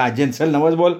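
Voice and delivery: slightly lifted prodding; mild impatient yell. A narrator's reading. Granddaughter telling grandmother to make the vow, mild yell. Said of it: "मी ओरडले".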